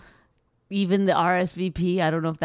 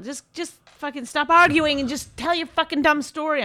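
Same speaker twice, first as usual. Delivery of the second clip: raised, exasperated outburst at co-host; getting pissed off; 8 semitones higher, 4 dB louder than usual. Real, not acted. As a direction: snap it out, fed up and louder. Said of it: "I'm getting pissed off".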